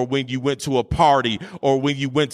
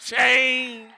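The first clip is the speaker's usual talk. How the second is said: fiery preaching; 12 semitones higher than usual. Acted. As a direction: preach it out to the whole church. Preaching at full force.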